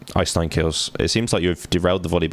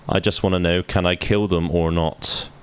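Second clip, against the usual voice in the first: ordinary talk, nothing marked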